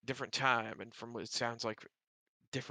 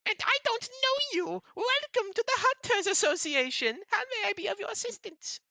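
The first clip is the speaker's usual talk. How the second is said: very high, loud chirpy greeter; excited owl; 8 dB louder than usual. Acted. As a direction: squeak it out like an eager little owl. Owl welcomes strangers.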